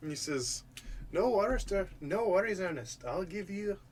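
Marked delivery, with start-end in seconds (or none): 1.1-3.8 higher-pitched, lightly attempted Irish accent. Mayor promises Ernest the credits, attempted Irish accent. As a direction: try for an easy Irish lilt. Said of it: "He's supposed to be Irish".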